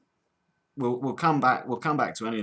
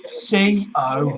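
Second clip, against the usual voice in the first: loud, forceful letters; exasperated spelling; 2 semitones higher, 7 dB louder than usual. Pretend. Cockney caller respelling for the agent, exasperated. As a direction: hammer out each letter.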